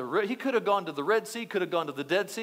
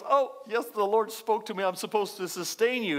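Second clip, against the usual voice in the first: mock pious widow. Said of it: "and she goes".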